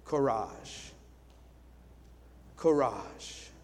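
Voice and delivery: soft, low hushed encouragement; whispered courage. Retold or imitated. Villagers urging a tired cyclist, softly whispered. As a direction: murmur it like villagers cheering a rider. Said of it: "They were whispering".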